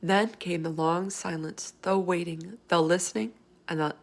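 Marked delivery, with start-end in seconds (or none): none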